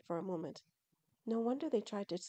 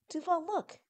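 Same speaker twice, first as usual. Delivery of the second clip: high, louder exclamation; excited urging; 9 semitones higher, 6 dB louder than usual. Acted. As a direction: call him over in excitement. Tillin urges son to look.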